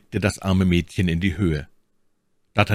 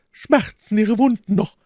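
much higher, louder tender lady's question; 5 dB louder than usual. Acted. My Lady asks the count about his wounds, tender. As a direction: ask with sweet concern.

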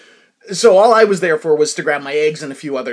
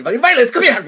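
higher, louder mock yell; imitated mother's call; 8 semitones higher, 7 dB louder than usual. Woman grabbing her found daughter, imitated urgent call. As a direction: call the child over urgently, imitating Mom.

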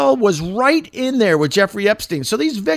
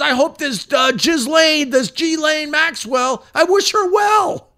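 mock Trump; 11 semitones higher than usual. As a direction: parody the president wishing her well. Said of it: "he goes".

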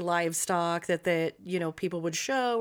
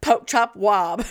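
put-on Southern accent; 6 semitones higher, 7 dB louder, slower than usual. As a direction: slur it like a Southern yokel. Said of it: "he's turned Southern".

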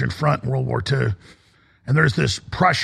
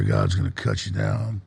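much lower and softer, a quiet doom; ominous low line; 8 semitones lower, 5 dB quieter than usual. Retold or imitated. Host repeating the [name] lyric more softly. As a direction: let it sink down to a hush.

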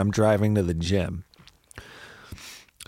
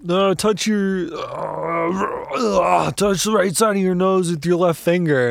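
lightly voiced flustered cop, slower start; storyteller imitating the confused cop, mildly higher; fumbling cop; 6 semitones higher, slower than usual. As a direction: stumble through the order like a nervous cop.

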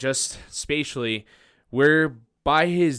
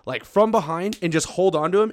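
ordinary talk

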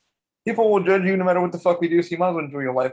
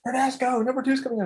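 high, eager announcement; acted-out excitement; 10 semitones higher than usual. Telling his bro number two's coming, excited. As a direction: break big news to a pal, pitched way up. Retelling excited news to friend.

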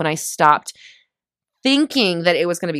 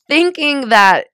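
usual speaking voice

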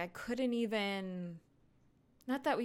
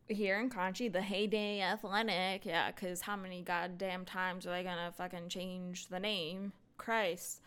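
ordinary talk, nothing marked

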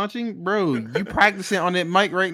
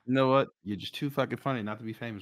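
low, soft, put-on solemn compliment; deep voice; 5 semitones lower, 7 dB quieter than usual. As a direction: drop deep and bestow praise quietly. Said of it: "Bro, go, go deeper".

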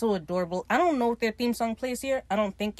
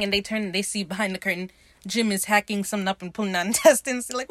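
normal talking voice

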